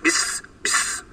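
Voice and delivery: بس whispered with a sharp hissing s; whispered s drill. Shown as a demonstration. Teacher drilling a clear seen sound. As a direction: hiss out بس with the tongue pulled back. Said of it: "لما أحس إن السين مكتومة عندي".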